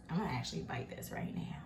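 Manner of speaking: whispered, about to eat. Real, not acted. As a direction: whisper it like a secret. Whispers before tasting.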